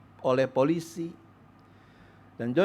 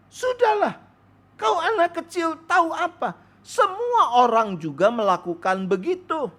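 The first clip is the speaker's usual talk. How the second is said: put-on high, sharp mother's rebuke brushing off the child; a mother cutting her child off, high and scolding; 12 semitones higher than usual. Mother silencing her son about the extra change, high and scolding.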